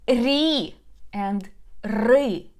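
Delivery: Ri then ri, slow, second one darker; ri versus ry. Shown as a demonstration. Teacher contrasts two syllables for students. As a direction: draw out both Ri and ri for contrast. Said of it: "Can you hear the difference?".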